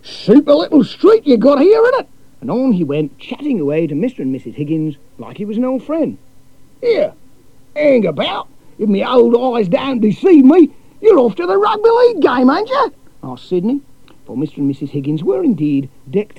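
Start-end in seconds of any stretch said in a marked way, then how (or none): 0.0-2.1 brash Aussie cousin. A narrator's reading. 6.8-12.9 brash Aussie cousin. A narrator's reading.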